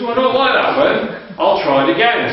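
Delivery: a hammed-up sulky reader turning down the verse; light mock fussy voice; mock woman's complaint. Woman rejecting a Bible verse.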